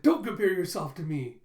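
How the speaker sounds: mock-offended outburst; indignant rebuff. Boy rejects being likened to his classmate, indignant.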